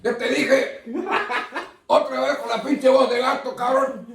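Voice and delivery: papá enojado en broma; mock scolding dad. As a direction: keep up the fed-up father bit. Dad scolding cat-voice son.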